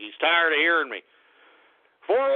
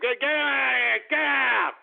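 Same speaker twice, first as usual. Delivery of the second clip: angry dismissal; 5 semitones higher than usual. Real, not acted. Host kicking a prank caller off, yelling angrily. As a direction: yell them off the line.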